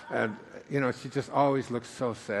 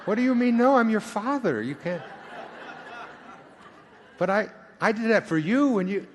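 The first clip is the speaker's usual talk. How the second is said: mock outraged father; 9 semitones higher than usual. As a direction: play the offended father, laughing it off. Acted row with his kids.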